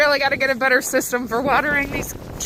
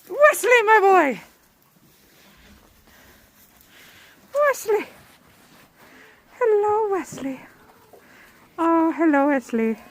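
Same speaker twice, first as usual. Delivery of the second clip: high-pitched British greeting, falling at the ends; put-on British voice, pitched up and swooping down; playful British accent; 5 semitones higher than usual. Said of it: "He's British".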